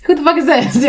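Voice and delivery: high-pitched mock guessing at a reaction; imitating laughing callers. Grandma's friends joking about her reaction, playfully imitated. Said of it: "they were, like, laughing, like".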